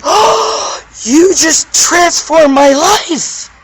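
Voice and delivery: high, slow, gushing mock praise; gushing grateful follower. Mocking someone thanking her for transforming them, high gushing voice. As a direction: mimic a starry-eyed admirer. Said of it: "that person to be like".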